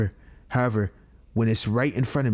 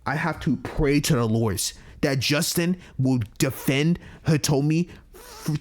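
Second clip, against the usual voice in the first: normal talking voice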